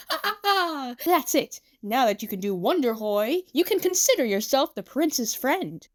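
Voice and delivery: hammy triumphant laugh, then praise; high, theatrical approval; proud showman. Acted. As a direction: grandly welcome a new friend.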